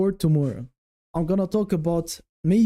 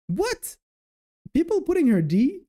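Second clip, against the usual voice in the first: much higher, incredulous outburst; shocked disbelief; 11 semitones higher than usual. Real, not acted. Shocked at viewers' low ranking.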